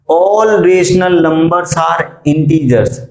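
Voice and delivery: each word drawn out slowly for the class; slow, deliberate quiz read-out; slow dictation. Shown as a demonstration. Teacher reads true/false statement.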